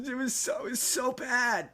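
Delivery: imitating his own near-tears in the car; mock upset whine. Recalls nearly crying in the car in a high mock whine. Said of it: "me being in the car, being".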